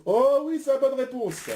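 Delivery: high, jubilant game-show exclamation; delighted confirmation. Real, not acted. Quiz host telling Arthur he is right, delighted. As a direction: celebrate the answer up high.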